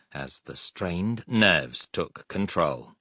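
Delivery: usual speaking voice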